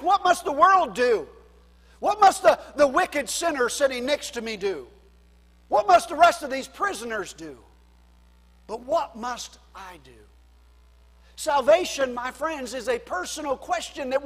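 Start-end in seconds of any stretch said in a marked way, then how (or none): 0.0-10.1 emphatic preaching. Acted. Preaching at full force.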